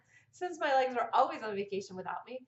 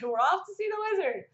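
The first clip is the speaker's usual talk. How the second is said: higher, louder mock-merry call; playful singsong; 6 semitones higher, 5 dB louder than usual. Worn-out mom clowning on the walk to the car, merry singsong. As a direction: chirp it to make everybody laugh. Clowning, quoting Wizard of Oz song.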